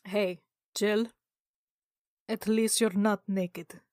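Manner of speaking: ordinary talk, nothing marked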